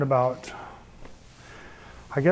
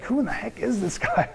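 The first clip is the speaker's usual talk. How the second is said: wife's puzzled voice; 7 semitones higher, 3 dB quieter than usual. Retold or imitated. Wife baffled by his anger.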